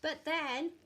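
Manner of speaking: ordinary delivery, nothing marked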